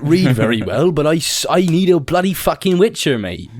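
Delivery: broad, hammed-up West Country peasant; West Country accent. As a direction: play a rustic villager with a West Country accent. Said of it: "stereotypical country bumpkin accent".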